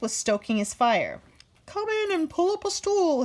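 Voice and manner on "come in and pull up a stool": higher, shouted welcome; shouting. Acted. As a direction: call him in from the forge. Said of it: "he shouted".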